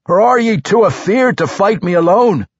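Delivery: high, loud Scottish-tinged dwarf; taunting dwarf. Acted. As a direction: goad him to fight.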